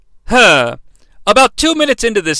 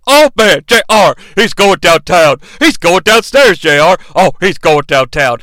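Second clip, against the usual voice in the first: Shane McMahon impression; 4 dB louder than usual. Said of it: "as he often declares".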